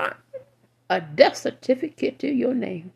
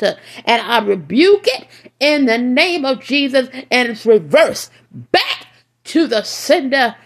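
fiery preaching; 2 semitones higher, 4 dB louder than usual. Acted. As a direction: preach it out with full force. Preaching at full force.

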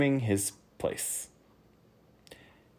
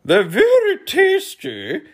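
very high, loud, slow humbug; 11 dB louder, slower than usual. A narrator's reading. Humbug explaining the cakes, very high and slow. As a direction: explain it in a piercingly high bug voice. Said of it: "explained the humbug".

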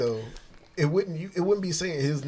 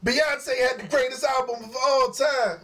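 high, slow megalomaniac rant; [name] megalomaniac rant; 10 semitones higher, slower than usual. Retold or imitated. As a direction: rant like a self-crowned genius. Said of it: "[name] pop up behind you".

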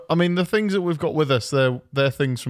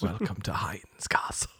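hushed, unvoiced eerie welcome; spooky whisper; 7 semitones lower, 10 dB quieter than usual. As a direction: whisper it like a haunted tour guide.

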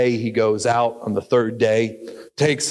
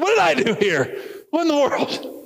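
puzzled mother; 14 semitones higher than usual. Acted. She's like.

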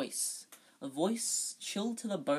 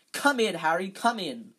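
loud, higher-pitched invitation; hearty welcome; 4 semitones higher, 8 dB louder than usual. A narrator's reading. Lockhart ushering Harry in, hearty welcome. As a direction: usher him in with gusto.

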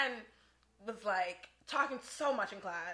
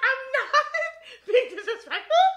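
laughing mock denial; 12 semitones higher, 8 dB louder than usual. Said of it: "She's like".

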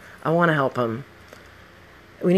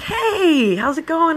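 much higher and louder, bursting with joy; delighted greeting; 4 dB louder, slower than usual. Retold or imitated. Greeting her old coworker Jesus on the street, high and overjoyed. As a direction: greet him like a long-lost friend.

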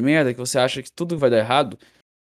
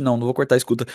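ordinary talk, nothing marked

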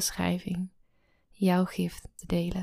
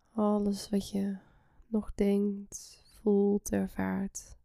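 soft spa whisper; 4 dB quieter than usual. Acted. Guides a relaxation meditation.

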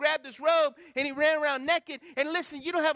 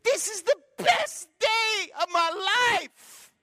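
put-on high, slow, louder little-girl delight; little girl's voice; 8 semitones higher, 7 dB louder, much slower than usual. Pastor quoting a little girl at the outreach, high delighted voice. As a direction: play the girl overjoyed with her gift. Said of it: "And she goes".

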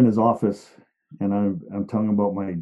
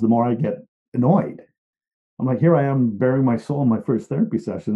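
ordinary talk, nothing marked

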